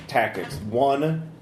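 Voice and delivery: normal talking voice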